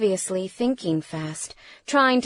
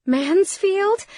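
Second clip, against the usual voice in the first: high, loud startled question; alarmed disbelief; 12 semitones higher, 5 dB louder than usual. A narrator's reading. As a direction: blurt the name in shock. Fear blasts through her at the name.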